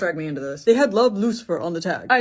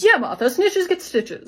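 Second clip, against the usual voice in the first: mocking singsong echo; 10 semitones higher, 3 dB louder, much slower than usual. Death echoing Rose's line at Martha.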